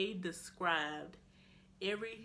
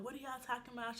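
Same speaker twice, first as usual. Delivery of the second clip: high, soft confused plea; imitated near tears; 6 semitones higher, 4 dB quieter than usual. Cornered girl to the group, imitated tearful confusion. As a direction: mimic a scared girl close to crying. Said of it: "She's, like, about to cry".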